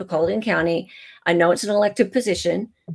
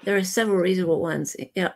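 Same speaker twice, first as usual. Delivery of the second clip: normal talking voice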